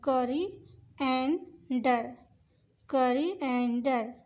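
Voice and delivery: कोरिएंडर drawn out very slowly in three pieces; slow three parts. Shown as a demonstration. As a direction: spell out कोरिएंडर by its syllables. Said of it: "इसको आप तीन portion कर लें". (कोरिएंडर को तीन हिस्सों में बहुत धीरे बोलना)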